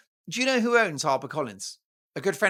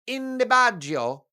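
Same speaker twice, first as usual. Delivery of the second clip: hammed-up Italian accent, slow; playful fake Italian accent; mock Italian; 5 semitones higher, 4 dB louder, slower than usual. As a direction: drawl it like a jokey Italian. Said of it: "As the Italians would say".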